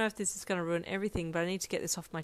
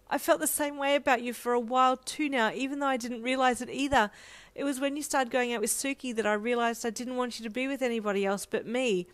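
higher, pressing confession; emotional outpouring; 5 semitones higher, 3 dB louder than usual. A narrator's reading. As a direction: let pent-up feelings spill out. Breathless pent-up confession.

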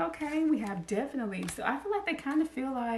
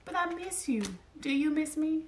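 higher, plaintive voice; longing admirer; 6 semitones higher than usual. Retold or imitated. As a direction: ask it like a lonely lover, voice raised.

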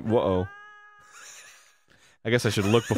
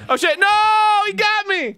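high, loud melodramatic wail; mock death panic; 5 dB louder, slower than usual. Player lamenting his game character's death, mock panic. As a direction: cry out as if fatally hit, hammed-up.

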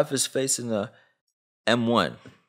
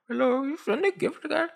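mock old grandma. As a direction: play a frail grandma answering the phone. Mocking a scammed grandma.

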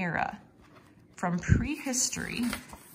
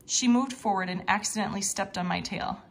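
ordinary delivery

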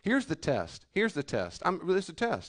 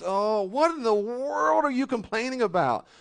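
higher, louder, slow outraged scolding; indignant rebuke; 8 semitones higher, 6 dB louder, slower than usual. Acted. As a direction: call out their ingratitude, slowly and loud. Preacher rebukes the complainers.

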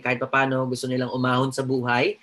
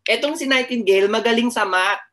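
teasing; 7 semitones higher, 6 dB louder than usual. Real, not acted. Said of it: "teasing the class".

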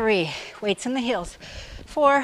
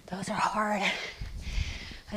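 out of breath; 2 semitones lower, 9 dB quieter, much slower than usual. Real, not acted. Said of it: "Sorry if I'm breathing heavy".

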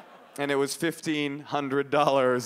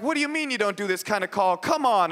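higher-pitched whiny protest; indignant pleading; 6 semitones higher than usual. Retold or imitated. Boy pushing the operator to relent, indignant pleading. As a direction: push back as if cheated. Retells begging the phone operator.

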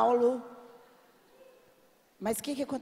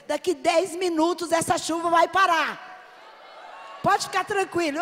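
bold declaration; 4 semitones higher than usual. Retold or imitated. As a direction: announce it with full faith. Declares rain will stop. (proclamação confiante e alta)

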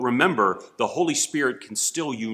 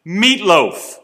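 slow, louder, exasperated retort; irritated; 5 semitones higher, 10 dB louder, much slower than usual. Retold or imitated. Wife irritated at her husband's repeated question, slow and loud. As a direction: drag it out, fed up with being asked. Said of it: "obviously irritated".

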